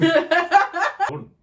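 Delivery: high, loud laugh through words; squealing laughter over the words; laughing burst. Real, not acted. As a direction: crack up mid-sentence.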